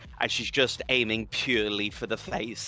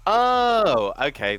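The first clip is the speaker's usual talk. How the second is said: surprised oh; 9 semitones higher, 8 dB louder, slower than usual. Real, not acted. Realises the shift hits fewer.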